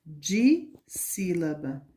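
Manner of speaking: "dissílaba" slowly, "di" held apart from "sílaba"; broken up slowly. Shown as a demonstration. Teacher naming the class for children, "dissílaba" slowly broken up. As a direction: pause after "di" in "dissílaba". Teacher sounds out the new term.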